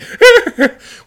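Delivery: high, loud mock whimper of fright; hammed-up squeals while fleeing; panicked squealing. Sailor running from the dragon, panicked squealing.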